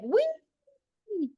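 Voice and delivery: put-on a high, gleeful "Wee!" like a child on a slide; playful wee. Urging listeners to drop heaviness, high childlike squeal. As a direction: let go with childlike glee. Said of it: "just be like".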